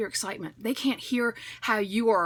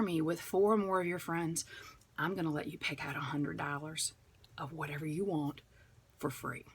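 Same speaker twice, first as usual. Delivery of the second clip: whispered and fading softer, secretive; 6 semitones lower, 8 dB quieter than usual. Real, not acted. Host whispering her best booking reward, fading softer. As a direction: whisper the big offer, letting it fade away. Whispered top perk offer.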